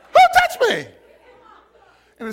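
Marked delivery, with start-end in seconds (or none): 0.1-0.9 high, startled question; startled Jesus. Acted. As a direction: whip round in sudden surprise. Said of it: "That's how he said it".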